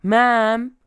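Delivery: loud, high-pitched yell. A narrator's reading. Mother yells at a worker leaving the back room, yelling. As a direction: yell it to stop someone short. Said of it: "mother yells at me".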